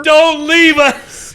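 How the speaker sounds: high, loud, drawn-out plea; mock begging. Begging a co-host to stay, mock wailing. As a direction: wail it like a hammed-up farewell.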